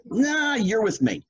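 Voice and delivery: high, breezy mock Richard; Richard insisting. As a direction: wave off the excuse playfully.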